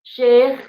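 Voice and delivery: "Syekh" loud and high with a strong e vowel; emphatic syekh. Shown as a demonstration. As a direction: punch out "Syekh" clearly for the class. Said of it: "Kubra itu seperti kita bilang syekh". ("Syekh" diucapkan keras dan tinggi)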